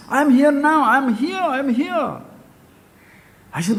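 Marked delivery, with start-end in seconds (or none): 0.1-2.2 put-on child insisting he is right there; insistent child. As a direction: repeat it like an impatient little boy. Voicing the small child.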